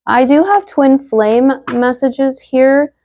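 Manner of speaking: ordinary talk, nothing marked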